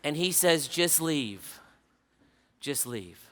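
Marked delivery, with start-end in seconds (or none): 0.7-1.6 slightly lowered voice, firm falling end; understated dismissal. Retold or imitated. Dying man dismisses visitor, first take. 2.6-3.3 very soft, low imitation of a dying man; faint dismissal. Dying man repeats it more faintly.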